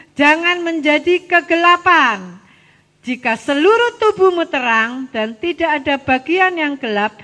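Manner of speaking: loud, measured read-aloud; scripture reading. Real, not acted. Teacher reading Luke with the children, loud recitation. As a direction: project the verse for everyone to follow.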